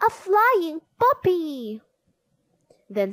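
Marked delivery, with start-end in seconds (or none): 0.1-1.8 high, loud cry of wonder; amazed shout. A narrator's reading. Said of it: "someone shouted".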